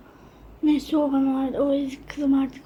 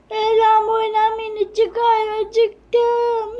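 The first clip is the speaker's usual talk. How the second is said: high, louder sing-song call; child doll calling; 6 semitones higher, 3 dB louder, much slower than usual. Acted. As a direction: call Ela to let her out.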